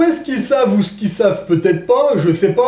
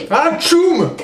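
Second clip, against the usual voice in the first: sneeze; 9 semitones higher than usual. Pretend. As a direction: sneeze it out sharply. Fake sneeze then joke.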